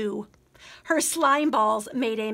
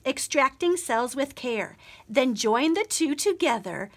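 plain reading voice

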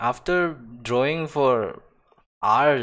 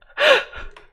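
squealing high laugh; high laugh burst; 12 dB louder than usual. Real, not acted. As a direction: let a shrill cackle burst out. Laughing at convenient character.

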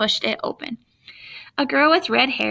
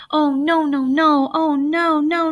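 high, louder despairing groans; boy groaning at his mistake; 5 semitones higher, 5 dB louder than usual.

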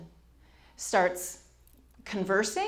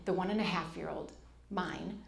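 ordinary talk

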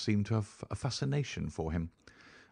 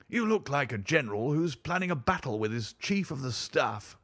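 higher, animated baronet voice; Sir Henry; 6 semitones higher than usual. Acted. Baronet greeting Holmes as a general, animated. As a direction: tease lightly with lifted pitch.

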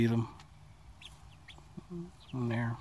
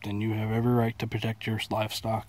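normal talking voice

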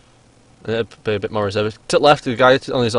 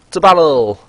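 battle cry; 6 semitones higher, 9 dB louder, slower than usual. Pretend. Starting the match.

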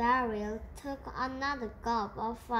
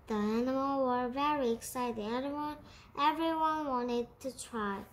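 plain reading voice

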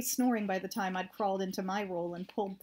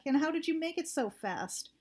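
higher call; shouting; 6 semitones higher than usual. A narrator's reading. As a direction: keep shouting across the distance. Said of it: "I shouted back".

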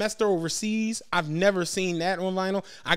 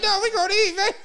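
laughing refusal; 11 semitones higher, 3 dB louder than usual. Retold or imitated. Retold polite refusal, laughing.

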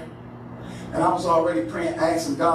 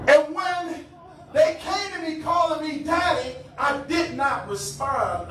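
high, emphatic sermon cadence; preaching; 9 semitones higher than usual. Real, not acted. Preacher likening his silence to the text, preaching. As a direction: build it high, then slow down on the refusal.

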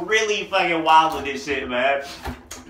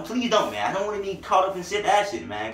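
ordinary talk, nothing marked

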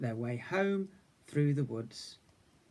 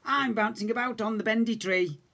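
higher, proud little bear; little cub voice; 8 semitones higher, 3 dB louder than usual. A narrator's reading. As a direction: boast while bouncing. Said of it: "Little Bear said".

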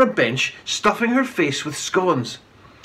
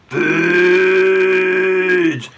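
groaned; 5 dB louder than usual. A narrator's reading. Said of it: "the crowd groaned".

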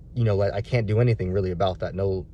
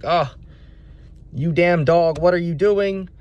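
raised, higher-pitched exasperated scolding; angry mock yelling; 7 semitones higher, 4 dB louder, slower than usual. Owner finally reaching the runaway dog, angry mock yelling. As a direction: act fed up, telling off the dog. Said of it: "yell at him all the way back".

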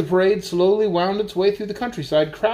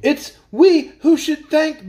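put-on higher, slow, louder boy voice; Milo's boy voice; 9 semitones higher, 6 dB louder, slower than usual. A narrator's reading. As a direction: speak up as an eager boy. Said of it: "began Milo when the shouting had subsided".

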